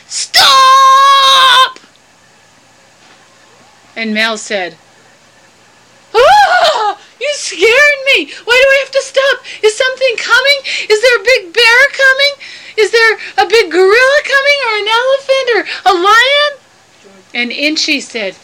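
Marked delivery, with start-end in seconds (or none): 0.1-1.8 high, very loud, long-held yell; yelled warning. Acted. Inchi halting Mel before he eats his branch, yelling. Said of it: "Inchi yelled". 6.1-16.5 high, loud, hammed-up fright; scream, then fire off panicky questions up high; startled panic. Mel frightened by Inchi's sudden yell, acted panic.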